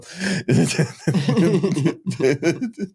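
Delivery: high-pitched run of chuckles; sustained high laugh; laughter. Real, not acted. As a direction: laugh it out in a long, high burst. Laughs at own remark.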